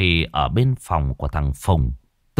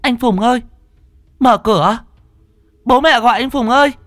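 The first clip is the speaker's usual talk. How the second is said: put-on much higher, louder girl's wail; 5 dB louder than usual. A narrator's reading. Sister wailing at her brother through the door. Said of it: "chõ mồm vào mà gào".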